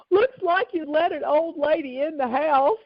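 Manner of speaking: high-pitched imitation of an upset old lady; retold complaint, pitched well up; upset mother. Mother locked out to daughter, imitated upset. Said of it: "she was so upset".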